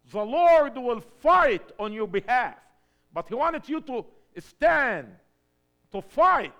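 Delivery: loud, high preaching cry; full-force preaching. Acted. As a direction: preach it out to the whole church.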